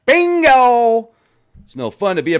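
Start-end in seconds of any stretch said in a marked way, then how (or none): 0.1-1.1 high, loud exclamation; excited shout. Real, not acted. Answering a viewer's comment with a loud triumphant shout.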